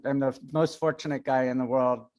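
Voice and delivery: usual speaking voice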